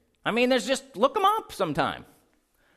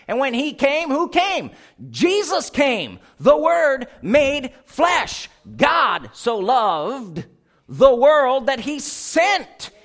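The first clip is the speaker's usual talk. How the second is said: building, louder preaching with slowed key phrases; 4 semitones higher, 5 dB louder than usual. Acted. Preacher rousing the church about God's word, building in force. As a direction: drive the point home to the congregation.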